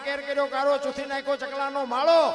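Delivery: loud, rhythmic chanted recital of a ballad; fiery dayro-style recitation of a village poem; folk poem recitation. Acted. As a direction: declaim each alliterative line in a driving rhythm, rising to a shout at the end. Recites Bhikhabhai Rabari's earthquake poem.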